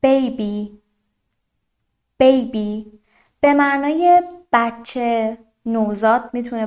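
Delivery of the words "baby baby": put-on Baby with a clear "ay" in the first syllable; Baby said singly, first vowel a full "ay"; long A sound. Shown as a demonstration. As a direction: lean on the "ay" in Baby. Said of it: "ببینید با صدای A".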